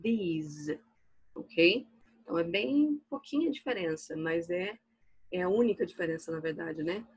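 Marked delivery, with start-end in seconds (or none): 0.0-0.8 these, ending in a buzzing Z; these with Z sound. Shown as a demonstration. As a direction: finish these on a Z. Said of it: "que é com som de Z". (these com som de Z)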